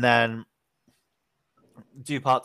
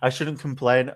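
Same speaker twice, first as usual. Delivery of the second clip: ordinary talk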